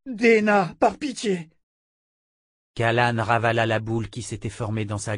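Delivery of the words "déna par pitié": high, trembling plea; pleading as if in pain; whimpering. A narrator's reading. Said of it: "gémit-il".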